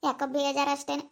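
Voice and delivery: low, soft, downcast denial; dejected. Acted. Insisting to a worried relative that nothing is wrong, dejected. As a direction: brush off concern in a subdued, low voice. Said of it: "ನೀನ್ ಯಾಕೋ ಬೇಜಾರಾಗಿದ್ದಿ".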